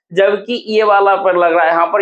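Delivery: ordinary delivery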